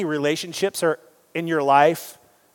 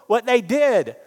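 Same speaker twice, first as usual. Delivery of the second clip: mock protest; 8 semitones higher, slower than usual. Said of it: "I can hear you screaming at me saying".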